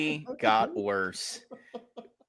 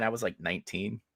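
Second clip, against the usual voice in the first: ordinary talk, nothing marked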